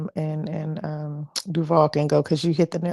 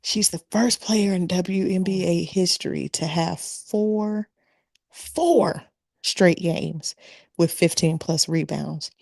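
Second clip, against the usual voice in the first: measured, with a long held FOUR and a pause; slow for emphasis. Real, not acted. Panelist driving home the rebound record, slow and emphatic. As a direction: lay it out piece by piece, stretching "four". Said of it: "I'ma say it slow".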